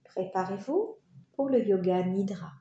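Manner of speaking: ordinary talk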